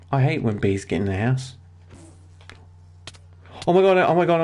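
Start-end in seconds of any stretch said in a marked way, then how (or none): 3.7-4.4 high, loud panicked oh my god; panicked yelling. Real, not acted. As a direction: yell it in sudden panic. Panicking at bee attack.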